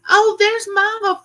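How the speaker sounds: ordinary talk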